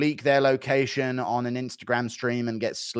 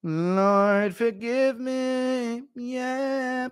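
mock prayer; 11 semitones higher, much slower than usual. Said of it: "mock prayer".

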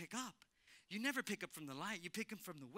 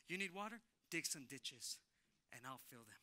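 calm divine promise; 5 semitones lower, 5 dB quieter than usual. Retold or imitated. Voices God's calm promise.

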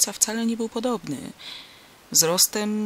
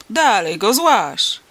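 loud, drawn-out angry command; exasperated farmer yelling slowly and forcefully; irritated shout; 3 semitones higher, 9 dB louder, much slower than usual. A narrator's reading. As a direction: shout him off the wagon. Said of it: "krzyknął zirytowany do ostateczności chłop".

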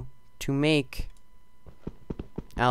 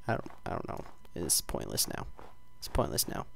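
hushed whispered despair; soft whispered give-up; defeated whisper; 8 semitones lower, 12 dB quieter than usual. Real, not acted. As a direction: breathe it out, beaten. Dejected muttered unvoiced.

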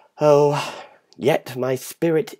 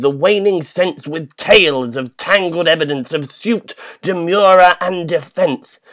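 raised, higher urgent call; an urgent loud plea; urging plea; 6 semitones higher, 5 dB louder than usual. Acted. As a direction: rouse him, plead loudly. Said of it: "I urged".

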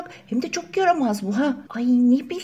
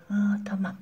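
whisper; 3 semitones lower, 8 dB quieter than usual. Acted. Whispered reply in secret.

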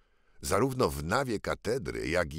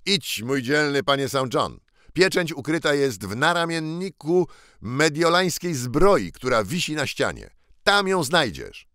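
a touch higher, urgent commands; eager boy king; 6 semitones higher, 4 dB louder than usual. Acted. Ragged claimant sending lord Saint John for the seal, eager. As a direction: send him off quickly, eager.

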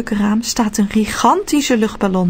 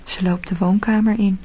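plain reading voice

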